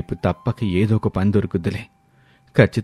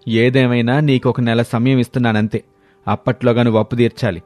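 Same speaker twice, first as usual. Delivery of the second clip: stern threat; 3 semitones higher than usual. Acted. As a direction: lay down the deadline like a threat. Moneylender issues ultimatum.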